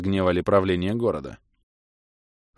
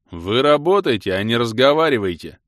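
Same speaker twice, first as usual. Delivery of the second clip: louder, grumbling rebuke; grumbling guard; 4 semitones higher, 7 dB louder than usual. A narrator's reading. Guard grumbling at chatting prisoners, louder rebuke. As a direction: scold them back to work. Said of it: "заворчал стражник".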